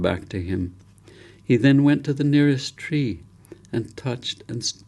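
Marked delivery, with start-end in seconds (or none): none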